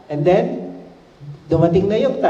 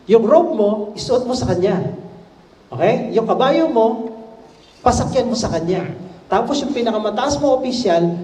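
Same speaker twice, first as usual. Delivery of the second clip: excited; 6 semitones higher than usual. A narrator's reading. Haman eagerly proposes honors.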